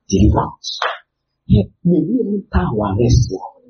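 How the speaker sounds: normal talking voice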